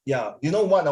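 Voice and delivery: normal talking voice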